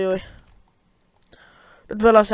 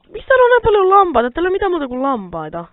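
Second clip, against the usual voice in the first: much higher, louder and fast; amazed outburst; 9 semitones higher, 6 dB louder, faster than usual. Real, not acted. So many sheep everywhere, fast high amazed rant. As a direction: rattle it off in disbelief at all the sheep. Finds field full of sheep.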